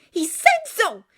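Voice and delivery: high, loud insistence; high, heated assertion; angry insistence. A narrator's reading. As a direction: insist on it loudly. Insists, then repeats louder.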